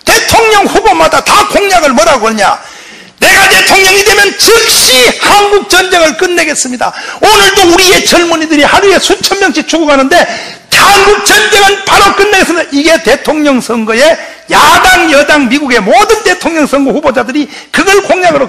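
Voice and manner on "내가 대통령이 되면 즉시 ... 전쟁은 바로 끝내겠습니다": campaign speech. Retold or imitated. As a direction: proclaim it like a candidate at a rally. Candidate's campaign promise, proclaimed.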